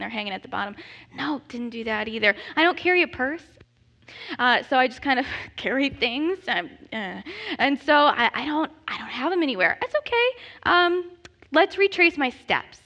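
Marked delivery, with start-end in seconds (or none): none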